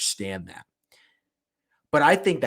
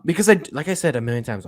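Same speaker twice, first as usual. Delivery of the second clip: usual speaking voice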